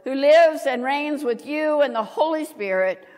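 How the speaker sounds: ordinary talk, nothing marked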